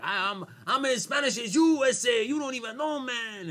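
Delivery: very high, loud Chicano accent impression; mock Chicano accent. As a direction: protest indignantly in an over-the-top Chicano accent.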